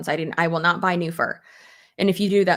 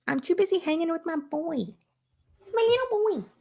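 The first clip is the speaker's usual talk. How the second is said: put-on high cooing baby talk, climbing higher; cutesy cooing; 9 semitones higher than usual. Mother talking of her little boy, high cutesy cooing. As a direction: coo it in a small, high voice.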